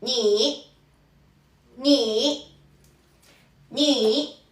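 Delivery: ni said clearly with a plain bright I; Mandarin ni demo. Shown as a demonstration. Teacher modelling the Mandarin syllable ni. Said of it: "qué sucede".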